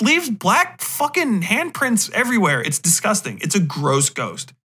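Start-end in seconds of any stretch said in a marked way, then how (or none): none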